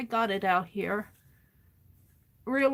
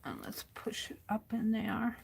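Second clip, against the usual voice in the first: whisper; 14 dB quieter than usual. Real, not acted. Whispering while she works.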